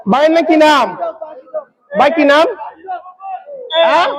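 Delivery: usual speaking voice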